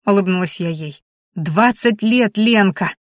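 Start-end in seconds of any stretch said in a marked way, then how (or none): none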